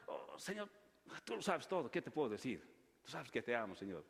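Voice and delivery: a broken man admitting his love; grieving Peter. Acted. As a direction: Pedro confessing to the Lord, fading low and soft.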